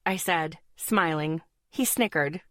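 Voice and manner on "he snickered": ordinary delivery, nothing marked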